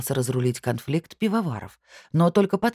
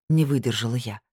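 usual speaking voice

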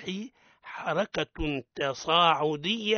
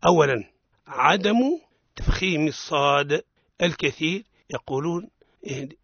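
ordinary delivery

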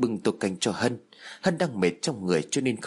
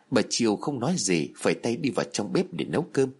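ordinary delivery

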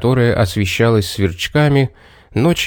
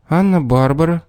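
put-on girl voice; 6 semitones higher than usual. A narrator's reading. Girl answers her name.

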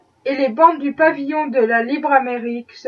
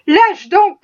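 louder, higher sharp command; shouted order; 5 semitones higher, 5 dB louder than usual. A narrator's reading. Man tearing the mother's hands away, louder shout. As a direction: yell at her to let go. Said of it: "cria l'homme".

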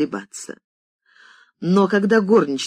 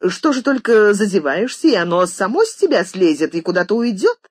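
sarcastic; 5 semitones higher, 4 dB louder than usual. A narrator's reading. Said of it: "съязвила она".

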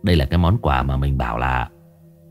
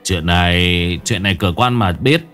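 gloating threat. Acted. Túc threatens his master.

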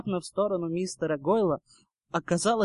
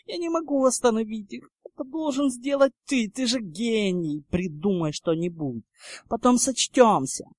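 higher-pitched, urgent pleading; desperate; 6 semitones higher than usual. A narrator's reading. Draco begging Potter to stop them, desperate. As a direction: beg with a touch of desperation. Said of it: "отчаянно шептал Драко".

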